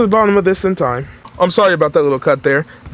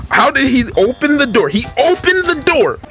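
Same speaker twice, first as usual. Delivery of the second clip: much higher astonished cry; amazed partner; 7 semitones higher than usual. Acted. Partner shocked the Guildmaster walked out asleep, high and amazed. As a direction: gasp it out in disbelief.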